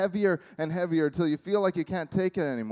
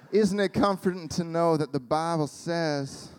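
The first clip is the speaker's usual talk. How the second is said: Southern woman. Acted. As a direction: play a comforting Southern friend. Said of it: "I became a Southern woman".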